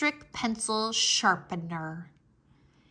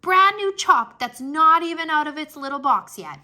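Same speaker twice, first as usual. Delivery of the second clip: louder and higher, thrilled; excited gushing; 6 semitones higher, 10 dB louder than usual. Acted. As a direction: gush over the new chalk. Junie gushes over the chalk.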